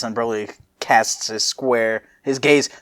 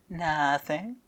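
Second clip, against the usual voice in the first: softer, bashful "Nothing" with a rise; shy nothing; 5 semitones higher, 9 dB quieter than usual. Shown as a demonstration. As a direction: mumble it, embarrassed. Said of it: "supposed to be more of a".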